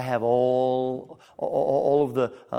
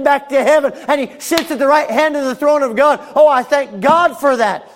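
loud, high, fervent sermon delivery; preaching at full force; 11 semitones higher, 12 dB louder than usual. Acted.

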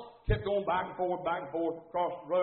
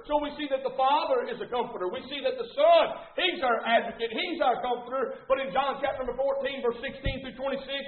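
higher, driving, impassioned pulpit cry; full-force preaching; 6 semitones higher, 4 dB louder than usual. Real, not acted. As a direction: build into a loud, high sermon climax.

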